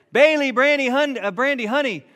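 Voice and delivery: loud, high calling out names; calling the dogs. Retold or imitated. Owner calling three dogs to the warm shed, loud high call. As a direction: holler for the dogs to come.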